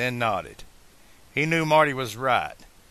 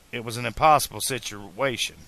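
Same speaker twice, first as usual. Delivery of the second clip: usual speaking voice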